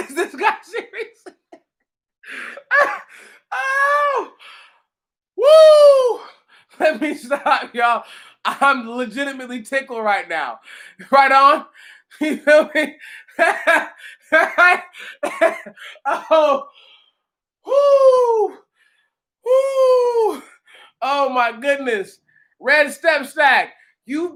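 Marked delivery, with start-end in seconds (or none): 3.6-6.2 high, loud laughing whoop. Real, not acted. Streamer can't stop laughing, high whoop. 17.7-20.4 high whooping; laughing whoop. Real, not acted. Streamer tickled by comment, high whoops.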